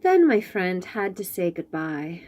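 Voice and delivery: ordinary delivery, nothing marked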